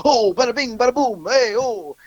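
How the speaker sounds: high-pitched fake Italian wiseguy; mocking, singsong fake-Italian voice up high; Fat Shitso impression. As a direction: ham up a cartoon Italian goombah. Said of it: "fake Italian guy".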